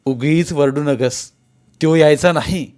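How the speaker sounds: gruff shout. A narrator's reading. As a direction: shout her down firmly. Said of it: "Dhanajirao ओरडला". (दरडावून ओरडत)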